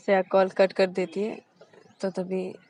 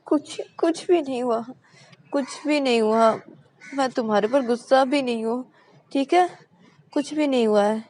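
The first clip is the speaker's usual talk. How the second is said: sulky reassurance; 5 semitones higher, 5 dB louder than usual. A narrator's reading. Shreya forced calm.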